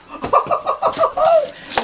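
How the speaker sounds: bright high-pitched laugh. Real, not acted. Laughs at timer.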